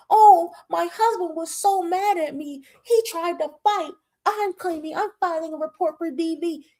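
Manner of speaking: high-pitched mock complaint; mocking Tierra. Vlogger mocking Tierra's complaint about her husband, high mock voice.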